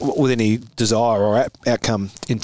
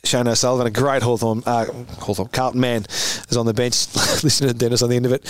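normal talking voice

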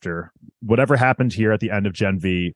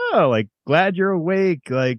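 mock casual; 7 semitones higher than usual. Mocking casual housemates' greeting.

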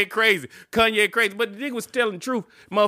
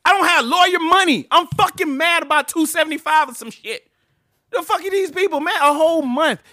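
high-pitched, louder disbelieving rant; outraged high rant; 11 semitones higher, 5 dB louder than usual. Real, not acted. Venting to his cohost about unpaid wages, outraged high rant. Ranting about not getting paid.

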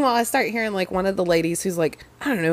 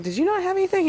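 high, surprised asking; incredulous question; 12 semitones higher, faster than usual. Retold or imitated. To the guy beside her, surprised high ask. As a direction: ask in mild disbelief.

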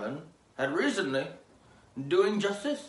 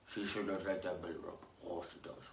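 much lower, very soft coo; 9 semitones lower, 13 dB quieter than usual. Pretend. Owner cooing about the cat's belly rub, hushed. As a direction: coo it like petting the cat.

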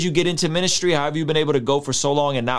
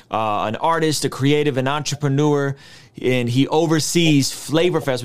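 ordinary delivery

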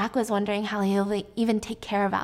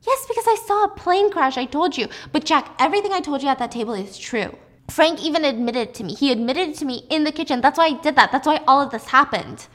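higher, pressing, agitated plea; urgent insistence; 5 semitones higher, 5 dB louder than usual. Acted. Wife telling husband about the plane crash, urgent.

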